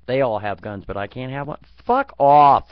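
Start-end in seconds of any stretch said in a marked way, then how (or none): 1.8-2.7 a sudden shouted fuck off, louder; loud dismissal. Real, not acted. Ranting about gun laws, shouts fuck off loudly. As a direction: blurt it out, louder, fed up.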